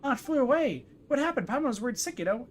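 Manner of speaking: high-pitched fretting companion; Paimon, worried. Acted. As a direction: fret in a high little character voice. Voices game character Paimon.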